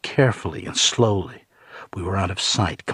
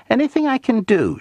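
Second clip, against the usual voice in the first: high-pitched, helpful eagerness; calm, interested eagerness; 4 dB louder than usual. A narrator's reading. Librarian offering help to a patron, eagerly interested. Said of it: "with the calm, interested eagerness".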